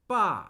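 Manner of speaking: Ba starting high, falling straight down; fourth tone on Ba. Shown as a demonstration. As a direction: drop "Ba" firmly, like a command. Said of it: "I'm starting higher".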